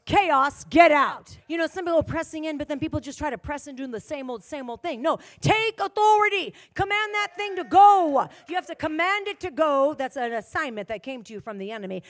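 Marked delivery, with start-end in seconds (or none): 0.0-1.4 loud, sharp command; commanding chaos to leave. Shown as a demonstration. Believer rebuking chaos in a moment of worship, loud. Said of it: "What do they need to do". 5.0-9.9 higher and louder command; forceful preaching. Acted. Preacher urging believers to take authority, forceful.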